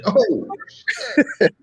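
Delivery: very high-pitched laughing exclamation; pleasantly stunned. Retold or imitated. Man retelling his first look at her unmasked, amazed.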